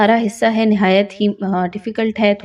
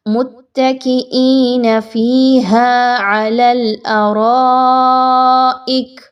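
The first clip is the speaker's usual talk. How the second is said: slow chant with the ra vowel drawn long; stretched madd; 3 semitones higher, 3 dB louder, much slower than usual. Shown as a demonstration. Teacher reciting the verse again to show the madd, slow chant. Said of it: "کھینچ کے لمبا کیجئے".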